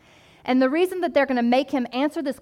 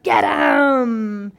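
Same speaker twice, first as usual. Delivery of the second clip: mock cheer rising to a shouted get him; root for him like a fan at a fight; 3 semitones higher, 4 dB louder, much slower than usual. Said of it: "the sinner in me wants to be like".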